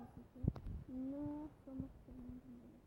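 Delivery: normal talking voice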